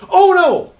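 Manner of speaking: sudden loud realisation; surprised shout. Real, not acted. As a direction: blurt it out in surprise. Suddenly recognises actor.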